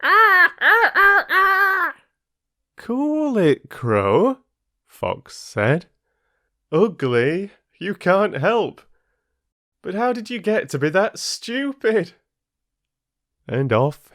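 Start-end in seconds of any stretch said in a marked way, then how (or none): 0.0-1.9 very high, loud, angry caws; an enraged crow screeching; furious crow cawing. Acted. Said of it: "hopping up and down on her branch in fury". 2.8-12.1 higher, taunting fox; a smug fox gloating; mocking fox.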